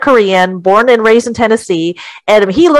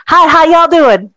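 a higher, louder Southern US accent greeting; 7 semitones higher, 3 dB louder than usual. Shown as a demonstration. Showing the group his Southern accent, a light Southern greeting. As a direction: greet folks like a Tennessee local in a Southern accent. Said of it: "I can do a southern voice".

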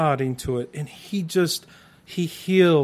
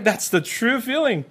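ordinary talk

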